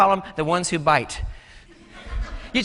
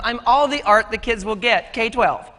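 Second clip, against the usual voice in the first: normal talking voice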